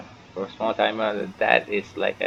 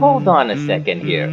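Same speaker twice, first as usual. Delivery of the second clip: startled outburst; 4 semitones higher, 8 dB louder than usual. Real, not acted. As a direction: stop everything, something's off. Streamer startled by game scene.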